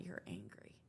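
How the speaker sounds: whispered retold observation; very soft, breath-only delivery; hushed revelation. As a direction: drop to a near-voiceless whisper. Husband gently names her anger.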